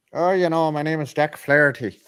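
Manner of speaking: badly faked Irish accent, lifted in pitch; horrible Irish accent. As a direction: put on a hammy, terrible Irish accent. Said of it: "That's a horrible Irish accent".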